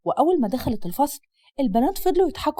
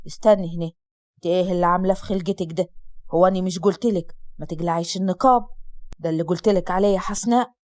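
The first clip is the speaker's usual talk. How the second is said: low, stern father stopping his daughter; scolding father; 6 semitones lower than usual. Acted. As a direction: drop the voice low and reprimand.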